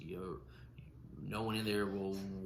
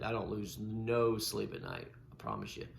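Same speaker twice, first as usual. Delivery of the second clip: ordinary talk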